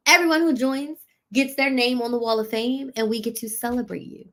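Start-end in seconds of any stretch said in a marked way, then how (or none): none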